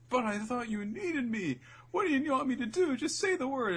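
high hammed-up eager girl voice; eager girl's plea. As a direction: beg sweetly to be needed. Baby Five pleading.

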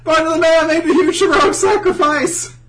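high, very loud melodramatic wail; mock anguish. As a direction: cry out in hammed-up grief. Listener mock-mourns the hero's sacrifice.

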